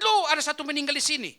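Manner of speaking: shocked at finding a body; man stumbling on a corpse at night, startled; startled passerby. Retold or imitated. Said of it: "dia kaget".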